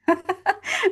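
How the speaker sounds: short high laugh; real laugh. Real, not acted. Mother admitting she hasn't mastered surrender, laughing. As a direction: let a genuine laugh slip out.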